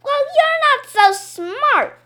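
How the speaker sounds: high, slow, loud taunt; snotty comeback. A narrator's reading. Boy taunting his classmate, slow and loud. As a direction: drag out the insult. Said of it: "I said".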